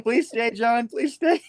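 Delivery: high, giggly please stay; begging a friend to stay, laughing. Real, not acted. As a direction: beg through laughter. Pleading playfully while laughing.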